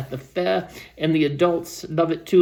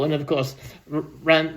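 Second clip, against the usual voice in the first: usual speaking voice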